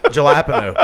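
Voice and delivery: "Jalapeno" with a hard English J, juh-LAP-uh-no; joke mispronunciation. Shown as a demonstration. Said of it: "How do you say jalapeno?".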